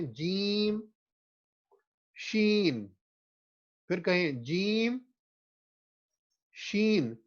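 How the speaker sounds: slow, careful جیم and شین; clear articulation. Shown as a demonstration. Teacher modelling letters for students, slow and clear. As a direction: pronounce جیم and شین slowly and distinctly. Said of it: "ایک دفعہ ویسے ہی کہہ لیں".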